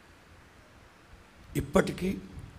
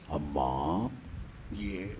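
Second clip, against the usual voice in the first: much lower, soft and tender; low gentle voice of Jesus; Jesus asking gently; 11 semitones lower, 9 dB quieter, much slower than usual. Acted. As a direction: ask her softly, like a comforting father. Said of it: "ఆదివారం తెల్లవారుజామున".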